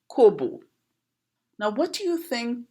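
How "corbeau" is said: corbeau said as "kobo", no r and both o's plain; Trini pronunciation. Shown as a demonstration. Teacher showing how Trinidad says corbeau, "kobo". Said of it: "We pronounce it".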